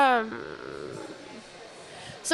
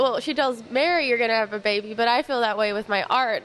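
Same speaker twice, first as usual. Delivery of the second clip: ordinary delivery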